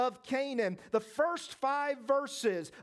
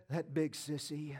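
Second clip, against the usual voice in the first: trembling voice; 8 semitones lower, 6 dB quieter than usual. Acted. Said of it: "in that very same trembling voice".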